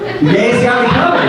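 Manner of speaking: laughing through the line. Real, not acted. Comic repeating the crowd's line, laughing. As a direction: crack up while repeating it.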